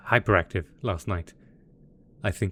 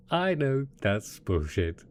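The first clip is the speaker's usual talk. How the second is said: ordinary delivery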